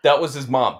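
ordinary talk, nothing marked

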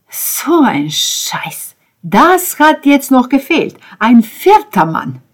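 slow, loud, high indignation; loud, drawn-out disgusted complaint; exasperated outburst. A narrator's reading. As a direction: drag out each word in outrage. Ben erupts at news of rival.

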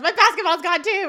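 high, loud comic wail; imitated distraught shout; retold yell. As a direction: yell it in mock panic. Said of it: "And Eric yells".

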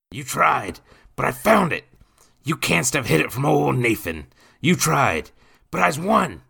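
put-on loud, high drunken crowing; boozy, victorious shouting; drunkard gloating. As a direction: crow in triumph over a beaten rival.

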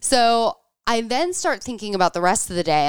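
ordinary talk, nothing marked

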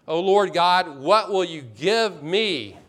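loud, drawn-out scripture quote as a plea; emphatic plea. Retold or imitated. Preacher quoting Abram's plea to God, slow emphatic appeal. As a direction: declaim it slowly, pressing each word.

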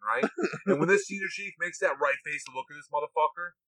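usual speaking voice

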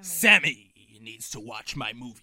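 loud, low pirate captain; Captain Barboza. Acted. Co-host chiding Sammy about his movie, pirate voice. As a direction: scold like a hammed-up pirate. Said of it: "You do sound like him".

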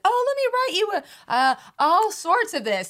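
high, loud mock enthusiasm; eager show-off. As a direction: play at being thrilled to answer. Acts out eagerly writing essays.

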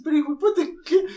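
very high, outraged squeak; shocked squeak. Retold or imitated. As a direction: protest in a tiny high voice. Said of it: "Y el man dice". (voz aguda e indignada)

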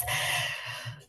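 trembling breath out, holding back tears; a shaky, tearful sigh. Real, not acted. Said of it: "I'm trying not to cry".

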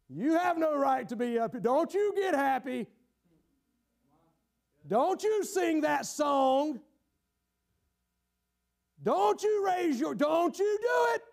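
slow, high, mounting reprimand; scolding shout. Acted. Inner accusers forbid the sinner getting happy, scolding shout. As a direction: bear down on every word, climbing into a shout.

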